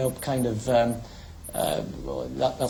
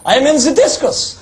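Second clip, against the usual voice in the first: much higher, louder mock German accent; 11 semitones higher, 7 dB louder than usual. German lad bluffing the guard, loud high mock German accent. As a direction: ham up a German athlete introducing himself. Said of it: "the German lad says".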